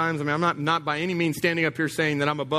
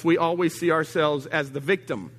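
ordinary talk

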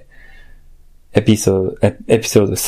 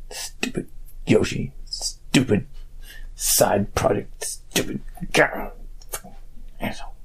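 much softer, breathy grumble under the breath; muttered aside; 2 semitones lower, 10 dB quieter than usual. Pretend. Host grumbling about busy cohost under breath. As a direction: bitterly grumble out of earshot. Bitter aside after saying not mad.

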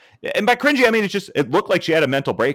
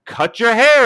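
high, loud, laughing mock taunt; high mocking heel voice; mock gloating heel; 9 semitones higher, 6 dB louder than usual. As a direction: ham up a smug heel threat. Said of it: "It's just like".

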